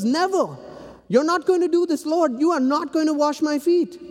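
high, emphatic refusal; protesting Peter. Acted. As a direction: refuse him point-blank.

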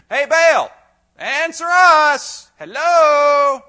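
high, loud, stretched-out call. Retold or imitated. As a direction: drag it out like yelling into the void. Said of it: "So say it louder".

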